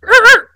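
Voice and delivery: high, short braking squeal; imitated car screech. Driver acting out slamming the car into park, high screech noise. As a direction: squeal like brakes, high and sudden.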